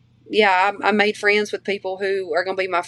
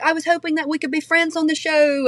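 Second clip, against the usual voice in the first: high-pitched British imitation of a friend; British accent; 8 semitones higher than usual. Co-star hoping to be friends on the show, high British imitation. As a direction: put on her British accent, pitched well up. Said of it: "really good British accent".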